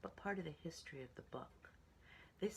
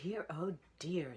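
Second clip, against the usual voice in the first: higher, louder, slow worried call; fretting mother; 4 semitones higher, 8 dB louder, slower than usual. A narrator's reading. Mother pig at a loss, fretful higher voice. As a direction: wring your hands out loud. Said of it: "said Piggy's mother".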